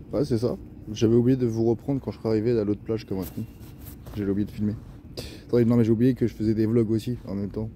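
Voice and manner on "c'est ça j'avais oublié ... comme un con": usual speaking voice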